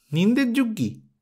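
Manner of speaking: "নিন্দের যুগ্যি" thrown back as a high rising question; high indignant echo of "নিন্দের যুগ্যি"; incredulous echo. A narrator's reading. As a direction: repeat his words back in disbelief. Girl echoes his words.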